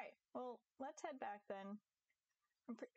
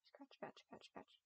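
whispered, softer scratch scratch; 4 semitones higher, 7 dB quieter, slower than usual. Real, not acted. Streamer scratching her cat, softer whisper. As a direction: murmur it under your breath while petting.

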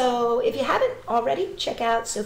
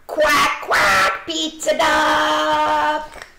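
quack; 6 semitones higher, 7 dB louder, much slower than usual. Pretend. Pizza duck quacks.

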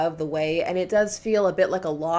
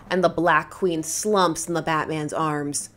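ordinary delivery

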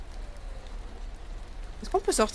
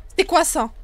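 sudden loud startled question; 5 semitones higher, 7 dB louder than usual. Real, not acted. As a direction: jump at the noise. Startled by a noise in game. (surprise, voix forte)

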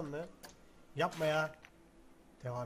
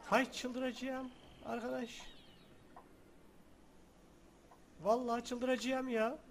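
drawn-out frustration; 4 semitones higher, slower than usual. Real, not acted. Frustrated gamer.

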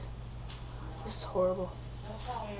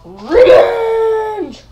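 high, loud, drawn-out battle yell; villainous cry; 9 semitones higher, 15 dB louder than usual. Acted. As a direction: roar it out as a hammed-up villain. Villain declares revenge.

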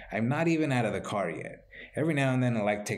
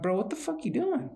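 much higher, disbelieving challenge; what on earth, bro, pitched way up; incredulous confrontation; 11 semitones higher than usual. Retold or imitated. Catching a stranger at his car door, high disbelief.

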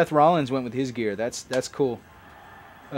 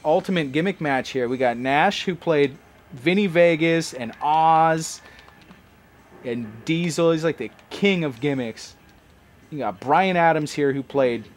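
ordinary talk

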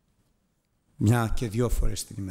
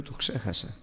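sheepish admission; 5 semitones lower, 6 dB quieter than usual. Retold or imitated. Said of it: "Θα κατεβάζω κάτω το κεφάλι".

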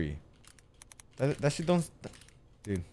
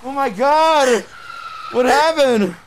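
slow drawn-out screams, much higher and louder; terrified shouting; 11 semitones higher, 5 dB louder, much slower than usual. Real, not acted. Player chased downstairs by the monster, screaming in terror. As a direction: shriek in real terror.

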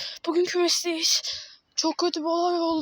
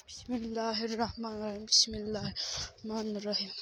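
low, murmured prayer under breath; hushed prayer; 4 semitones lower, 8 dB quieter than usual. Real, not acted. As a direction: whisper the prayer nervously. Afraid of hawk, murmured prayer.

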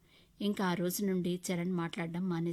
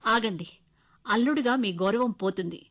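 louder, cutting reply; sharp retort; 2 semitones higher, 8 dB louder than usual. Acted. Wife defending her father's money, loud sharp retort. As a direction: stand up to him sharply. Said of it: "అన్నాను విసురుగా".

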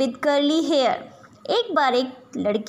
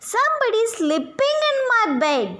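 put-on teeny little voice; 8 semitones higher, faster than usual. A narrator's reading. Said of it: "in his teeny little voice".

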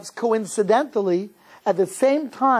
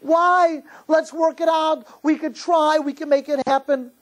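tearful pleading; 6 semitones higher than usual. Acted. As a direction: beg him not to leave, crying. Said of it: "she's crying at the table".

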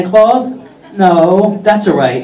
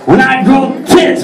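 higher, punchy mock retort; defiant comeback; 5 semitones higher than usual. Santa talking back to his wife, high defiant retort. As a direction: fire back with rising bravado. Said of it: "And I'm all like".

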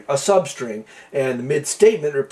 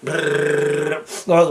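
long rolled brrrr like a printer; sustained trill mimicking a teletype at work; old teletype imitation. Shown as a demonstration. Programmer summing up what the program simulates, imitated teletype trill. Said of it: "it simulates an old teletype with".

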